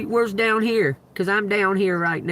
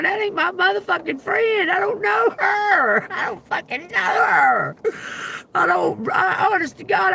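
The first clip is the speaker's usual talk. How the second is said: high shouted protest, much higher; indignant denial; 11 semitones higher than usual. Retold or imitated. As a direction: yell that you don't know her. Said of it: "And I'm going".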